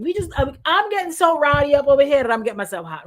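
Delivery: usual speaking voice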